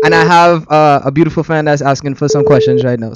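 easygoing Trinidadian lilt; casual chat in a Trinidadian accent. Shown as a demonstration. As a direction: speak with your Trinidadian accent. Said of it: "say something in your Trinidadian accent".

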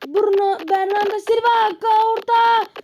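high loud calling of names; live commentary of his own attack; excited play-by-play. Real, not acted. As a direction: call the attack as it builds.

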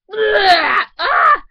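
a sudden very loud, high yell of disgust; shocked gross-out scream; disgusted shout. Acted. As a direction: recoil with a yell. Gets thrown up on.

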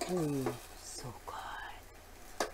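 slow, breathy hushed savoring; whisper. Real, not acted. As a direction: murmur it under the breath.

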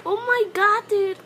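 usual speaking voice

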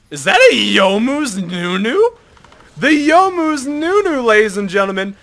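high, loud, slowed showman announcement; announcer hype. Real, not acted. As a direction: present it to the crowd. Announcing a funny enemy build.

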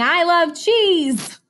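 high, slow mouse voice; mouse character. Acted. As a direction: draw it out like a delighted mouse. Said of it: "What do you think that mouse is saying".

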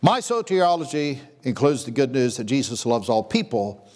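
ordinary talk, nothing marked

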